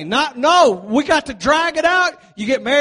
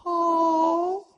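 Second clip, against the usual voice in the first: crowd cooing; 6 semitones higher than usual. Retold or imitated. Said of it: "and everybody's".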